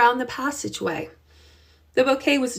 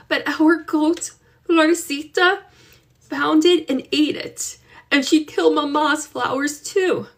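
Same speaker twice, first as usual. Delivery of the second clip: put-on higher, trembling girl's voice near tears; shaky voice; 6 semitones higher than usual. Girl shows her ruined bouquet to her aunt, shaky. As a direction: hold back tears while offering the gift. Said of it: "she said in a shaky voice".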